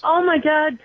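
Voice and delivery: gasp, then a high startled "oh my God"; shocked gasp. Real, not acted. Cohost suddenly recognizing something, gasping in surprise. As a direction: caught off guard by a sudden memory.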